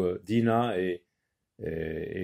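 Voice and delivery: ordinary delivery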